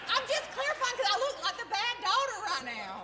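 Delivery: high, loud, laughing protest; playful protest. Real, not acted. Daughter defending herself from looking bad, high loud protest. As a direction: call out in mock indignation, high and loud.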